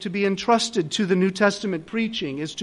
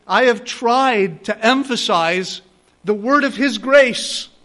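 louder, urgent sermon delivery; impassioned preaching; 4 semitones higher, 7 dB louder than usual. Acted. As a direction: plead with them as you leave.

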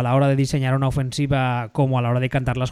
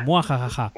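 risa de villano exagerada; risa malvada. Pretend. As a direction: carcajada malvada de broma. Se burla del rival derrotado. (risa malvada de villano)

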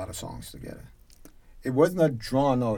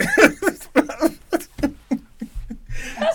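high, loud burst of laughter; real high-pitched laughing fit; big laugh; 7 dB louder than usual. Real, not acted. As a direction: laugh long at the punchline. Laughing after the story's punchline.

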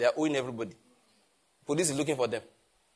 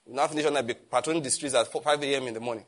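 ordinary delivery

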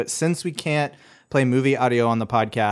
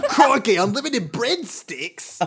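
slow, much higher mock Aussie voice through laughter; hammed-up Aussie crowing about breadsticks; Australian accent; 10 semitones higher, slower than usual. Cohost joining the Olive Garden bit, laughing Australian accent. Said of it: "saying Italian dishes in an Australian accent".

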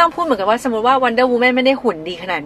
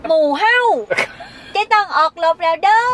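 rally for battle in a mock Isan accent; Isan dialect; 6 semitones higher than usual. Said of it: "ถ้าเกิดมาแถวอีสานนี่ไง".

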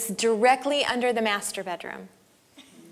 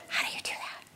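whispered question; 12 dB quieter, faster than usual. Retold or imitated. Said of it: "I'm like".

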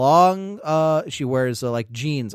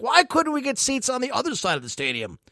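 high-pitched mock whine; bitching; 7 semitones higher, 4 dB quieter than usual. Legal Tender griping about the seats, mock whiny. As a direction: imitate a griping complainer in a higher voice. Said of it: "bitching the whole time".

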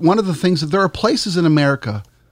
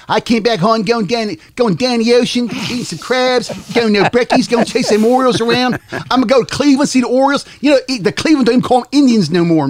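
put-on much higher, broad Baltimore accent; 9 semitones higher than usual. Back from Baltimore, showing off a much higher Baltimore accent. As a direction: play up a thick Baltimore accent. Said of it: "I still have a Baltimore accent".

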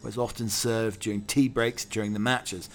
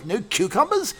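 higher shocked posh query; Algernon aghast; 11 semitones higher, 4 dB louder than usual. Acted. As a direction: gasp it like a fop.

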